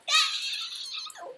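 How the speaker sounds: very high-pitched mock scream of no; shrill comic wail of protest; horrified "no" squeal. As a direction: squeal it in exaggerated horror. Reacting to taking off ring.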